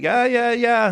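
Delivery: high, loud, eager yes-yes; high bright agreeing yeahs; mock eager cops. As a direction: nod along like confused cops. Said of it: "They're like".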